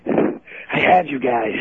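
slow, winded complaint; panting hard on the phone; breathing into phone. Real, not acted. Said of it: "Stop breathing into the phone".

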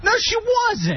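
mock denial. Quoting himself denying the girl was hot, a high mock protest. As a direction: squeal it out in hammed-up disbelief. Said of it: "I'm like".

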